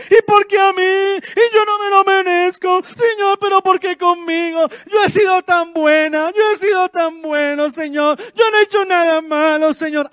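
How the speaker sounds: loud, high whining self-pity; whiny crybaby. Pretend. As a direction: wail like a spoiled child complaining to God. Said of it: "llorando como un niño". (queja llorona de niño, aguda y fuerte)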